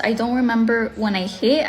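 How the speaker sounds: ordinary delivery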